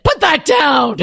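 stern mom warning; sharp mom command. Retold or imitated. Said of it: "That's your mom voice".

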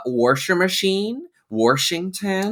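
washer and Washington with R, "worsher", "Worshington"; grandpa's intrusive R. Retold or imitated. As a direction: put an R before the sh in washer and Washington. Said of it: "my grandpa said that all the time".

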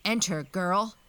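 loud, stern order; commanding captor's shout. A narrator's reading. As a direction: order her in with full force. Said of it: "in stern, commanding tones".

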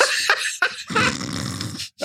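a high, breathless wheeze of a laugh; helpless silent high giggle; losing it completely at the joke; squealing laugh. Real, not acted. Cracking up at dad text.

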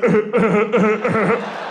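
imitated suppressed chuckles in bursts; stifled snickering. Best friend watching his prank work, imitated stifled laughter. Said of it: "George was like".

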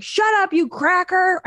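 put-on high squeaky kid retort; little son sassing his mom in a high voice. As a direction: sass back like a small kid. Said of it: "He's like".

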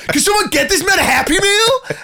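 high, loud jeering call across the ward; mocking call for a Happy Meal; high mock shout. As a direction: yell it out like a cheeky neighbour. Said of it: "It was like".

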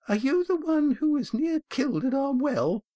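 put-on a small boy peering up; young boy. A narrator's reading. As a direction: ask like a wide-eyed little boy.

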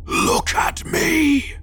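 slow, high rasping shriek; monstrous snap. A narrator's reading. Gray man demands the driver's attention, harsh stretched snap. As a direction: draw it out as a harsh monster's scream. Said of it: "he snapped".

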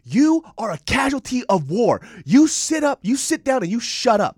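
drill sergeant yelling. Retold or imitated. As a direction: tear into her like a drill sergeant. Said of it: "she fucking went in on this girl".

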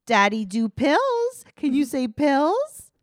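high, loud mock baby talk; slow, exaggerated cooing at a toddler. Imitating her teaching the child words, loud baby talk. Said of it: "like, to a baby".

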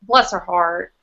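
low, understated Southern pity; tone-laden bless-her-heart. Shown as a demonstration. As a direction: say it low, letting the tone carry the meaning. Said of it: "it's about the tone, obviously".

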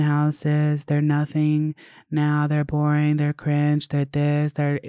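droning monotone imitation of haters; sing-song mock complaint list; mocked online commenters. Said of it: "people were in the fucking comments saying".

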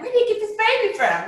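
high, loud mock bewilderment; disbelief. Mother amazed at newborn's arrival, high acted disbelief. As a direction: act stunned, voice shooting up. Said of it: "I just couldn't believe it".

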